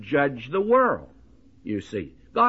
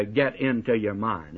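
usual speaking voice

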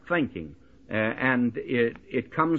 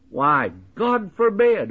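higher-pitched, forceful rejection; emphatic denial; 6 semitones higher, 4 dB louder than usual. Acted. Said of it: "an emphatic and categorical denial".

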